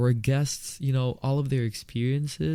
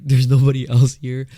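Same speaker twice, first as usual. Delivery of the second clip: mocking; 2 semitones higher, 11 dB louder than usual. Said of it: "you're probably like".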